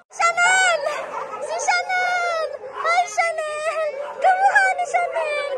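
gleeful calling. Real, not acted. Vlogger finds a dog like her pet Chanel, gleeful high calls. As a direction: squeal the name with joy.

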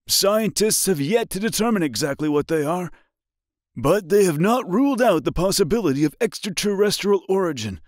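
high muffled emergency broadcast; muffled radio voice. A narrator's reading. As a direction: read like a crackly news bulletin. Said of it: "followed by a muffled voice".

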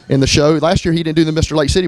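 ordinary delivery

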